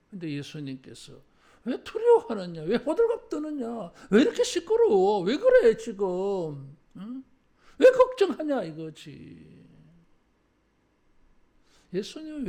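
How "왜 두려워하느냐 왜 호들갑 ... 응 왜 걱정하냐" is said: 웃으며 꾸짖는 예수님. Acted. 호들갑 떠는 제자를 꾸짖는 예수.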